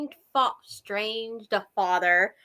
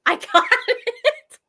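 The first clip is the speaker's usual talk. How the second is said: laughing; 11 semitones higher, 6 dB louder than usual. Real, not acted. Laughing at own joke.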